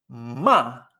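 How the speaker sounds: "Ma" dropping sharply, mock cross; fourth tone. Shown as a demonstration. Teacher insisting she isn't really angry, "Ma" sharply falling. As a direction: fling "Ma" down dry and short. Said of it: "el cuarto tono es así".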